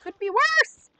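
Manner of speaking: high, loud yelp; strained yelp. Real, not acted. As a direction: shout it high, rising at the end. Yelp during the game.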